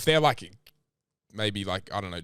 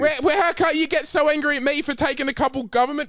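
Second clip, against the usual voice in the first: angry shout; 11 semitones higher than usual. Acted. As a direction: yell it in his face. Shouting during the scamming argument.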